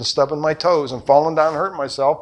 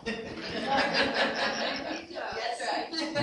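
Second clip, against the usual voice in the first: amused high laugh; 10 semitones higher, 12 dB quieter than usual. Real, not acted. Laughing after punchline.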